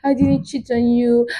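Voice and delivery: quiet, sweet pleading; soft pleading girlfriend. Retold or imitated. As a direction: coax him gently like a sorry girlfriend. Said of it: "she was like".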